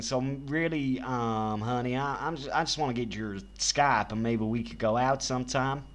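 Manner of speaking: normal talking voice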